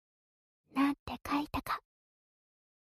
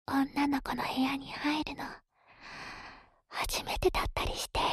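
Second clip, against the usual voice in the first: whispering. Acted. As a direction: breathe the words into the ear. ASMR ear whisper teasing.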